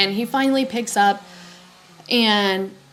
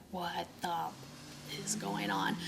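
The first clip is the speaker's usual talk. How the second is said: very quiet, faint and aghast; re-enacting dread, voice dropped to almost nothing; stunned shock; 3 semitones lower, 18 dB quieter than usual. Realizing what the neighbors did, hushed stunned shock.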